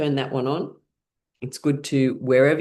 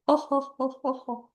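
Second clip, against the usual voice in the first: high ha-has fading softer; pitched-up, evenly spaced laughing sounds; fake laughter; 10 semitones higher, 4 dB quieter, faster than usual. Shown as a demonstration. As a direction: let the exercise laugh tail away. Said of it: "as you're making laughing sounds".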